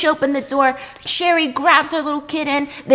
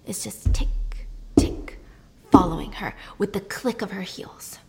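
hushed whisper; 4 semitones lower, 5 dB quieter than usual. A narrator's reading. Creepy heels stalking, told hushed.

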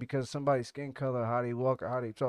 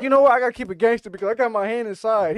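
excited; 7 semitones higher, 12 dB louder than usual. Retold or imitated. Resolving to stay cool.

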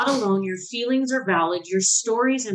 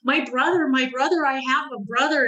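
higher-pitched retold crying child; mock tearful, raised pitch; imitating tearful boys; 5 semitones higher than usual. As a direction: lightly act out a boy overcome at meeting his brother. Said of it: "both of my boys are crying".

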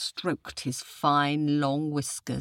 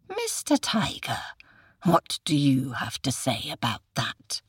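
hissing cobra. A narrator's reading. Cobra challenges the tiger, whispery snake voice. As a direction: hiss the question like a snake.

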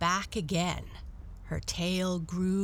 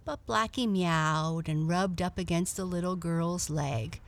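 ordinary talk, nothing marked

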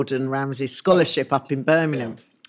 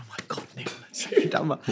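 hushed, breathy star-struck whisper; excited whisper; 4 semitones lower, 8 dB quieter than usual. Retold or imitated. To her husband, spotting a famous actor, an excited whisper. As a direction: whisper it so the star won't hear. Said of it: "I was so excited".

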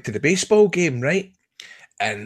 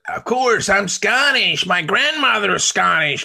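higher, drawn-out American twang; mock American accent; 6 semitones higher, slower than usual. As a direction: play a Yank proudly claiming Scottish roots. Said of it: "He's one of the Yanks that".

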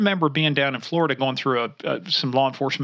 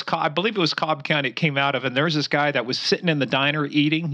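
ordinary delivery

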